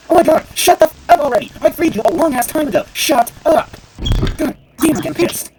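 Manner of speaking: high, loud, fast screaming; furious shout. Real, not acted. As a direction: lose it completely at the character. Screaming at nagging NPC.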